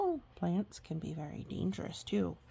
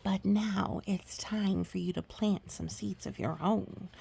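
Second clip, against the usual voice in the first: ordinary delivery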